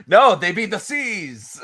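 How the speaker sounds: slow, high laughing pirate punchline. Pretend. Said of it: "what's a pirate's favorite letter".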